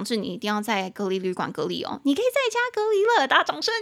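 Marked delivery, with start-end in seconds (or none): 2.0-3.7 excited announcement. Acted. Teacher cheering the class over home quarantine news. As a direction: burst out with it like great news.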